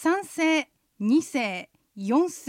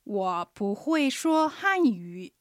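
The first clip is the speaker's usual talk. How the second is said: slow, careful Mandarin; slow teaching demo; slow model phrase; 2 semitones lower, much slower than usual. Shown as a demonstration. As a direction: say it slowly for repetition. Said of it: "発音と合わせて言ってみましょう".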